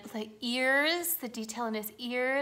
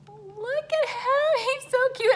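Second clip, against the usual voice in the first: cooing over cuteness; 7 dB louder than usual. Real, not acted. Vlogger melting over tiny Grogu, high cooing squeal.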